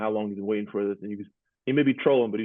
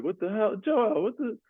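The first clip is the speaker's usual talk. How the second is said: much higher, laughing what-the-hell; mock disbelief; 12 semitones higher, slower than usual. Player teasing teammate's crying face with high disbelief. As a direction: squeal it in amused disbelief. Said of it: "like, giggle, laugh at him".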